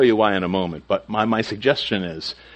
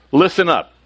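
higher-pitched parental call to attention; parent to kids; 7 semitones higher, 4 dB louder than usual. Retold or imitated. Parent telling kids to listen up, mildly commanding. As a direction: get the children's attention, a touch firm. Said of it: "all said it to our kids".

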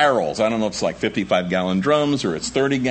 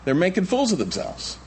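ordinary talk, nothing marked

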